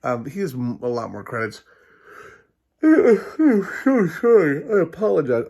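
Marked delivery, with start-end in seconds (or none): 2.8-5.5 words stretched through a big yawn. Real, not acted. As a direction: talk right through a yawn. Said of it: "yawning like that".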